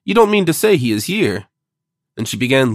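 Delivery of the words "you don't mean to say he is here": higher-pitched, incredulous question; astonished cry. A narrator's reading. Duchess learning the chiromantist is here, astonished cry. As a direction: ask it in alarmed disbelief. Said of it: "cried the Duchess".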